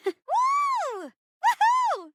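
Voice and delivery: high, whooping "woo-hoo"; excited cheer. Acted. Actor cheering at a curtain call, excited whoop.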